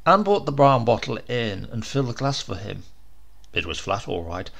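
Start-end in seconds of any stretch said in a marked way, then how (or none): none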